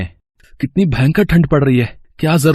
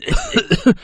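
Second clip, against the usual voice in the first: sick cough. Acted.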